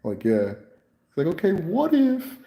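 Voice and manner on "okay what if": high, slow musing voice; same high imitation of the patient. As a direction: float the idea up high. Patient voice resumes.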